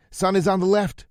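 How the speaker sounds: high, loud yell into phone. A narrator's reading. Rosie yelling to Lou over a bad phone line. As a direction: yell to be heard. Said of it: "Rosie yelled".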